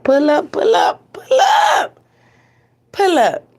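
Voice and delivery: rising hype call, the middle one a high shout. Acted. Host calling viewers into the stream, excited high shout. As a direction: hype the crowd in, cry it out high.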